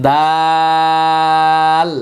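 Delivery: দাল held long, thin and clear; letter contrast demo. Shown as a demonstration. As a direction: stretch দাল slowly, light and clear. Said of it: "এটা হচ্ছে".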